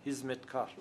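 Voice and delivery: هیزمتکار as "hizmetkar"; Turkish pronunciation. Shown as a demonstration. Linguist repeats the common Turkish word, هیزمتکار with h and z.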